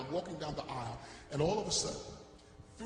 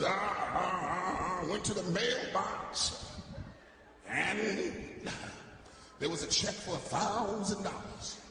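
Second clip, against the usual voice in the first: very slow, drawn-out church testimony; old deacon testifying; slower than usual. Retold or imitated. Old deacon telling church about his mailbox, slow testifying. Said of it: "old deacon testifying in the church".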